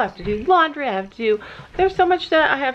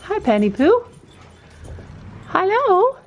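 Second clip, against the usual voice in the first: 1 high greeting; 9 semitones higher than usual. Real, not acted. Greeting pony.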